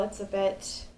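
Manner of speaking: normal talking voice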